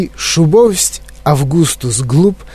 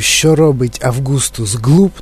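usual speaking voice

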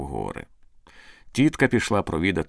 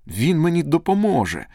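higher-pitched burst of glad hope; joyful relief; 8 semitones higher, 4 dB louder than usual. A narrator's reading. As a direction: exclaim it with sudden joy. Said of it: "зрадів Андрій". (радісний вигук)

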